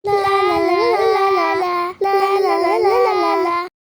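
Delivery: loud wailing no over and over; anguished no. Acted. Girl wails at friends who finished studying. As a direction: cry out in despair. Girl dismayed at exam news.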